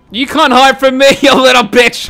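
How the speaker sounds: loud high taunting yell; villain taunt. Pretend. Villain hunting a hiding kid, loud gloating taunt.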